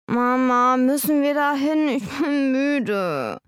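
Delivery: put-on high whining little boy; whiny child. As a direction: whine it like a tired child. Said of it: "jammerte er".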